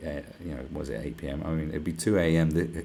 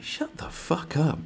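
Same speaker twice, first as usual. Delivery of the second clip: slow scornful dismissal; 9 semitones higher, slower than usual. Real, not acted. Dismissing the virtue signallers.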